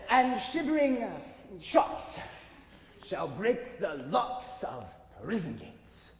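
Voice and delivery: slow, booming ham tyrant recital; tyrant's vein. Acted. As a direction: rant it like a stage tyrant. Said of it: "This is Ercles' vein, a tyrant's vein".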